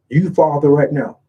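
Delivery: ordinary talk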